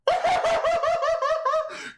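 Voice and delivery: shrieking high laugh; loud high cackling laugh. Retold or imitated. Imitated laughing downstairs.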